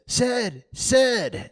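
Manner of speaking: put-on high, impatient boy calling his brother; a boy trying to wake his brother, annoyed; aggravated boy. Tom trying to wake Sid, annoyed call. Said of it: "Tom was aggravated".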